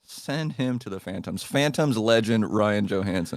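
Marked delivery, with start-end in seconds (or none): 0.0-1.4 softer, dry repeat; quiet aside. Pretend. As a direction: mutter it as an aside. Repeated line, two takes.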